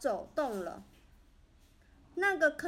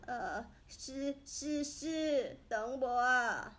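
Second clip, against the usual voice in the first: put-on hesitant old man; slower than usual. A narrator's reading. Old grandpa replies hesitantly.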